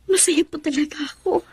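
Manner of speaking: soft breathy whisper. Acted. Daughter whispering to her mother. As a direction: hush it out tenderly.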